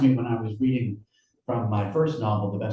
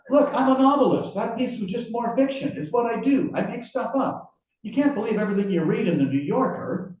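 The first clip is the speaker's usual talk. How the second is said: high-pitched, hurried self-defence; pleading protest; 11 semitones higher than usual. Acted. Writer explaining to his interrogator it was fiction, protesting. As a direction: talk your way out of it, pitched up.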